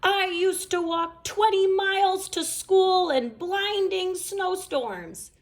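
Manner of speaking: high, grandly boastful; hammed-up tall tale; pompous old-timer. A narrator's reading. Papa Bear boasting about walking to school.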